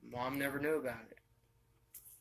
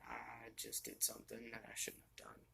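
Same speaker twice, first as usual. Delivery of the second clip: hushed, lower and much softer; whispered confession; 13 semitones lower, 11 dB quieter than usual. Retold or imitated. Telling his girlfriend on the phone what he did, in a whisper. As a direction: whisper it like a guilty secret.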